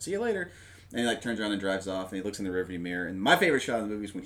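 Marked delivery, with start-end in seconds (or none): 0.0-0.5 high, sing-song mock goodbye; mocking sheriff send-off. Host repeats the sheriff's taunt to Rambo, high sing-song mockery. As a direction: chirp it sarcastically up high.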